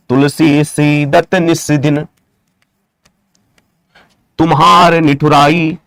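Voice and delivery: very slow, drawn-out, level recitation; slow dictation. Shown as a demonstration. Teacher dictating a verse example, very slow dictation. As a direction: stretch every word out as if for writing.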